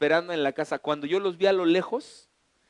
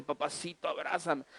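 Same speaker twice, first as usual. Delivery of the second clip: soft coaxing wife; 9 dB quieter than usual. Retold or imitated. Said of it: "así que me dice".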